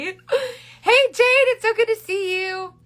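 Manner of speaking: high, loud, delighted hello; excited greeting. Real, not acted. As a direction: squeal hello to a friend who just arrived. Greeting a viewer who joined.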